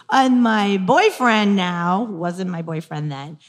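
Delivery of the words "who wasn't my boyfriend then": ordinary talk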